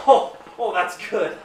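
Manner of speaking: amused retold laugh. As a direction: chuckle out "that's good" like a friend impressed by the prank. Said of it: "He busts up laughing".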